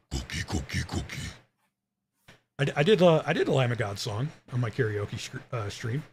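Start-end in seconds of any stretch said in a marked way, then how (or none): 0.1-1.2 low, distorted Cookie Monster growl; deep gravelly monster growl. Shown as a demonstration. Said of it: "I need more Ride a Cookie Monster".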